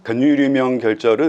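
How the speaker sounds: ordinary delivery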